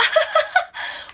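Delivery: a short airless laugh, high; breathy laugh. Real, not acted. Laughing to viewers at her own chaos, breathy laugh. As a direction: laugh at your own mess.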